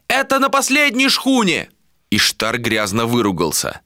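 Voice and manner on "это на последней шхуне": very high shouted report; calling across water. A narrator's reading. Sailor reports from afar, high calling shout. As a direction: shout the news from ship to ship. Reply shouted from another ship.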